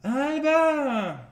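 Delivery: high, drawn-out mock cry; mock French Alba. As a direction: parody a weak foreign shout.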